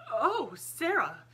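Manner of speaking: high, loud, drawn-out surprised cry; flustered headmistress exclaims; startled exclamation. A narrator's reading. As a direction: caught off guard and covering it. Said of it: "she exclaimed".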